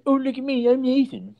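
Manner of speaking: high-pitched mocking singsong; Nathan. Mocks Nathan in put-on voice.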